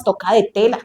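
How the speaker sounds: normal talking voice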